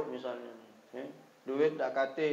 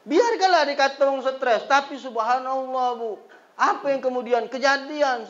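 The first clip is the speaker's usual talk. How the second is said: fervent preaching; 8 semitones higher, 6 dB louder than usual. Acted. Preaching at full force.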